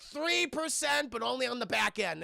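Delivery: lightly voiced German scientist; German villain accent. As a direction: bargain coolly in a cartoon German accent. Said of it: "stereotypical evil German Nazi scientist".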